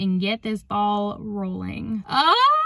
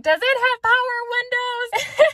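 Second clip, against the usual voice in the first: high, excited re-enacted question; excited hopeful question; 12 semitones higher, 3 dB louder than usual. Buyer peering into the Jeep, high excited question. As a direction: pitch it way up, hardly daring to hope.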